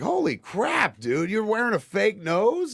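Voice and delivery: high-pitched retold astonishment; re-enacted gleeful disbelief; amazed. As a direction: act out a stunned discovery, pitch leaping up. Retold amazement at fake nose.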